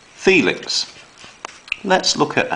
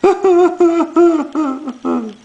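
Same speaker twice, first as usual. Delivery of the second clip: mock wailing sob; 7 dB louder than usual. Teacher acts crying bear again.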